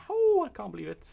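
real excited cry; thrilled. Real, not acted. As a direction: squeal in disbelief. Sees Rome's gate nearby.

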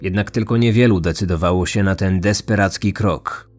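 usual speaking voice

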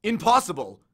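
shocked outburst; cry out. A narrator's reading. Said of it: "the servant cried".

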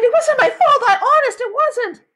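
put-on high, loud girl protesting; panicked cry. A narrator's reading. Sheila denying fault after the fall, high panicked cry. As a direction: cry it out in frantic self-defence. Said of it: "Sheila cried".

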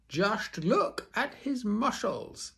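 high, slow showman's boast; ringmaster boasting. Acted. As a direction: show off the muscles to the crowd. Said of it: "said the ringmaster".

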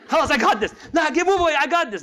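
high, loud, brushing others aside; mock take-charge shout. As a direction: jump in loudly as dad taking over. Acts baba trying to take over.

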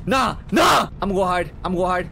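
loud, high scared yell; panicked shout. Real, not acted. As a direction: shout it and run to hide. Panicking at the ghosts.

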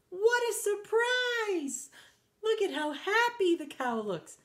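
high-pitched storybook amazement; big, high wonder; delighted surprise. Acted. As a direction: marvel aloud for young listeners. Reader reacts to story's surprise.

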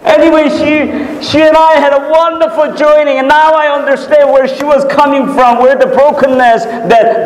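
high, fervent preacher's voice; excited sermon delivery, pitch held up; impassioned preaching. Real, not acted. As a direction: preach it high and eager, easing gradually.

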